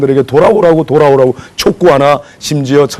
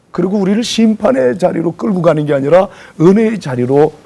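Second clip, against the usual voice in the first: usual speaking voice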